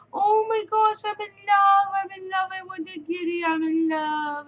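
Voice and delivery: put-on high, loud gushing kid voice; imitating daughter's squeal over kittens; excited child. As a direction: squeal like a kid in love with a kitty. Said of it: "She's like".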